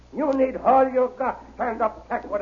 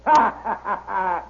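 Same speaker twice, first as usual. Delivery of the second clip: mocking laugh; 4 dB louder than usual.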